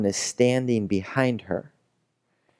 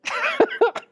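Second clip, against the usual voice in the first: high giggle. Real, not acted.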